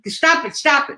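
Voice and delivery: high-pitched imitation of a fed-up friend; mock exasperated plea. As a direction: plead like an irritated poker buddy. Imitates annoyed poker friends.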